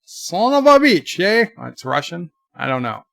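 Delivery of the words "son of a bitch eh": high, botched Aussie; fake Aussie accent. Shown as a demonstration. Streamer attempting fake ways, bad Aussie accent. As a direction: try an Aussie accent, pitched up. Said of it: "I could do Aussie".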